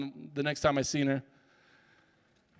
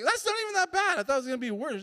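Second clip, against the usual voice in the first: relieved surprise; 10 semitones higher, faster than usual. Retold or imitated. Relieved it's only a letter.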